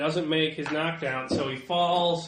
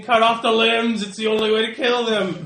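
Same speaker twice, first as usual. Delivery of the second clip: raised, higher mock scream; acted screaming; 5 semitones higher, 5 dB louder than usual. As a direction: voice a monster's scream at half strength. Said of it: "one of them is screaming".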